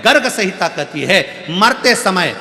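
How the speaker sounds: fervent preaching. Acted. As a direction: preach it to the crowd, hammering each line. Preaches the katha moral.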